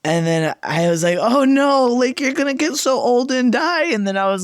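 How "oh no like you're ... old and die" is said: high-pitched mock-weepy dismay; acted tearful fretting, pitched up; retold crying. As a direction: wail lightly over a beloved pet. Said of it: "crying again".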